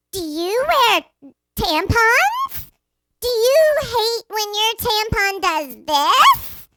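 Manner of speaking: high, drawn-out mock ad read; loud commercial announcer. Retelling the ad that blared by the pool, mock announcer. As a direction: imitate a blaring tampon commercial, slow and high. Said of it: "the tampon commercial came on really loud".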